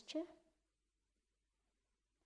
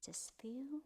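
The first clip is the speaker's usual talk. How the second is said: guided meditation; 2 semitones higher, 6 dB quieter than usual. Acted. Teacher guiding the group through a body scan, in a hushed whisper. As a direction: ease them through the body, part by part.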